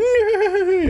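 high, loud imitated neigh; whinny like a horse; shrill horse impression. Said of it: "A horse just went".

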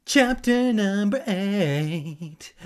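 loud, high, drawn-out announcement; hammed-up announcer. Reader announces the next chapter, hammed-up loud calling. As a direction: belt out the heading slowly.

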